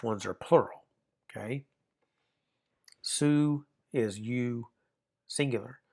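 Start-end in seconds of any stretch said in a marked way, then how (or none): 3.0-4.7 su said slowly, drawn out; teacher modelling a Greek pronoun slowly. Shown as a demonstration. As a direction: slowly sound out su for the class. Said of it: "that's the diphthong there".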